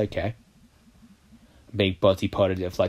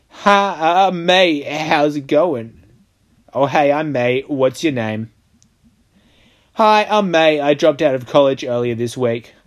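bright greeting; 6 semitones higher, 5 dB louder than usual. Pretend. Rehearsing greetings.

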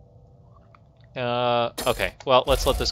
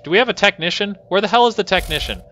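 higher, louder, irritated questioning; exasperated demand; 8 semitones higher, 5 dB louder than usual. Real, not acted. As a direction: demand to know where they are, fed up. Annoyed search for the missing technician.

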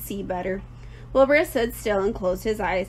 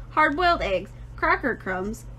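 usual speaking voice

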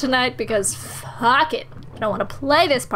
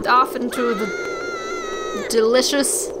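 high laughing drawn-out words; much slower than usual. Acted. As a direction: giggle through it slowly.

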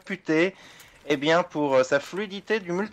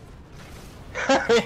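high laugh; 9 semitones higher than usual. Real, not acted. Laughs at friend's struggle.

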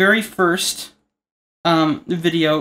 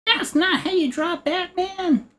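high, slow whiny heckler voice; mock critic voice; 13 semitones higher, slower than usual. Mock heckler whining to the artist about the drawing. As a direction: whine it like an offended fan.

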